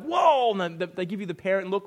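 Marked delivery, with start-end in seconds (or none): 0.0-0.6 loud high startled whoa; startled kids. Retold or imitated. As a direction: kids nearly hitting a shopper's trolley, loud startled cry. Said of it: "they're like".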